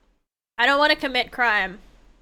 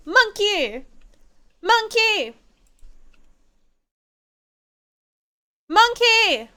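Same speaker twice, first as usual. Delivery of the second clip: calling out; 9 semitones higher, much slower than usual. Real, not acted. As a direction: yell after the monkey as it runs. Calling out at the monkey.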